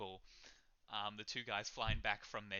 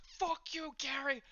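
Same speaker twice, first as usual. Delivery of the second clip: put-on high, loud drunken patron's curse; acted furious yell; angry outburst; 6 dB louder, slower than usual. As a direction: lash out in high-pitched anger. Drunk patron snaps at friend.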